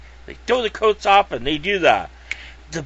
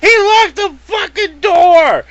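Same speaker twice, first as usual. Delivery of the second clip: high and loud, laughing through the words; real laughing outburst, slow and high; laughing disbelief; 10 semitones higher, 8 dB louder, slower than usual. Real, not acted. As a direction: crack up while getting the words out.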